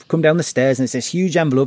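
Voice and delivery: normal talking voice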